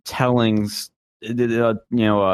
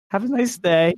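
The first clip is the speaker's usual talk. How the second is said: higher-pitched, mock-timid farewell; imitated scared politeness; 8 semitones higher than usual. Campaigner escaping an armed stranger, mock-timid politeness. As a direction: squeak out a polite goodbye, acting scared. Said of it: "speechless and scared".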